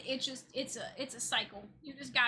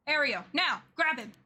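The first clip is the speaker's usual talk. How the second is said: louder, higher rallying shout; urgent battle call; 4 semitones higher, 10 dB louder than usual. Acted. Hero to partner in the fight, urgent shouts. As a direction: call the partner to grab him now.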